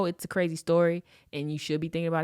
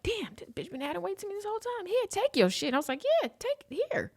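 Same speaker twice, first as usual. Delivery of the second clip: high, strained mock griping; exasperated. Man handing back her stuff, exasperated. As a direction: act out the fed-up complaint way up high.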